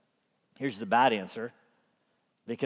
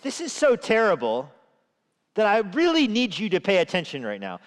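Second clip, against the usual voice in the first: urgent plea; 8 semitones higher, 6 dB louder than usual. Retold or imitated. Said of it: "communicating to God in such a way".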